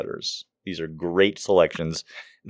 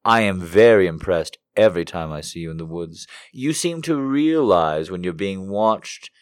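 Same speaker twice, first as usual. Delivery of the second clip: loud, high, theatrical reading of a creepy letter; hammed-up dramatic reading voice; 5 semitones higher, 6 dB louder than usual. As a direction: read it like a melodramatic confession. Reads love letter aloud dramatically.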